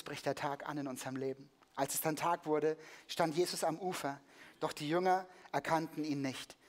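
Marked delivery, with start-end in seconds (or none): none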